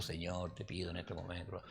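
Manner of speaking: barely audible prayer to the Lord; praying very softly. Shown as a demonstration. Pastor showing youth how softly he prayed, murmured. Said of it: "hablando bajito".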